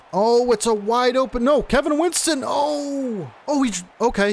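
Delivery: high excited burst; excited shout. Real, not acted. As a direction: yell in sudden excitement. Wide open play, excited reaction.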